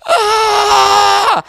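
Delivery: sudden piercing scream, very high and loud; full-voiced anguished shout; crying scream. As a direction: cry out as the power hits. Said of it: "and I'm screaming".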